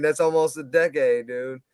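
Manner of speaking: usual speaking voice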